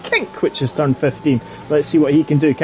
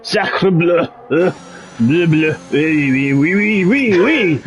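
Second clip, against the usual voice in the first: mock French; slower than usual. Mocking French driver.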